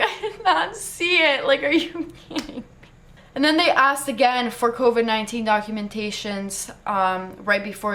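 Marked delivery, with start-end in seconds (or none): 0.0-2.6 laughing disbelief. Retold or imitated.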